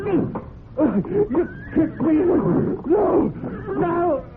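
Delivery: very high, slow sobbing cries; terrified crying. Acted. Trainer kicked and dying, crying out in high terror. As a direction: wail in pain and terror.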